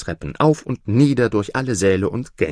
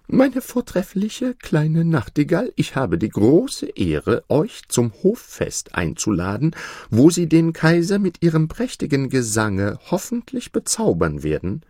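higher, pompous ceremonious voice; formal steward; 6 semitones higher than usual. Acted. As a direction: invite with exaggerated ceremony.

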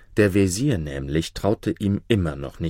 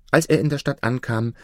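ordinary delivery, nothing marked

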